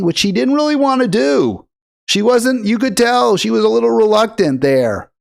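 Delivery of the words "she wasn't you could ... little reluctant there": normal talking voice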